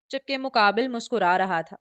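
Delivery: plain reading voice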